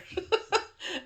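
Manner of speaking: a real high laugh breaking out; high burst of laughter. Real, not acted. Laughing at her own story about late parcels, high real laugh. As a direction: laugh at your own rant, high and free.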